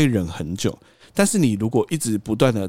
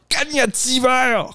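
high, shrieking curse at the supervisor; furious cursing shout. Retold or imitated. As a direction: blow up and curse him out. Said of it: "我就这样骂".

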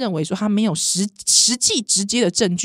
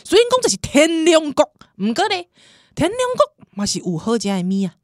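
hammed-up Taiwanese promo voice, raised pitch; mock food show host; 8 semitones higher than usual. Host jokingly pitching Tianmu food to outside friends, hammed-up promo. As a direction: sell the noodles like a TV host.

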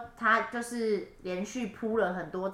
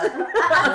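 burst of laughter; 8 semitones higher, 9 dB louder than usual. Real, not acted.

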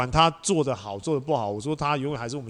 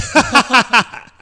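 high burst of laughter; loud laugh; 8 semitones higher, 11 dB louder than usual. Real, not acted. Telling friends about slipping on stairs, loud laugh.

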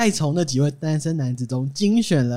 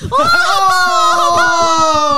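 high, loud exaggerated "oh"; overdone gasp of awe; mock amazed shout; 7 dB louder than usual.

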